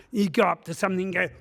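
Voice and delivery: ordinary talk, nothing marked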